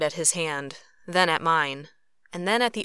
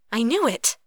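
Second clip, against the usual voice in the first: higher, vindicated exclamation; 6 semitones higher, 4 dB louder than usual. A narrator's reading. Katori seizing on Cruz's admission, vindicated. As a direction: burst out, proven right. Triumphant accusation after his admission.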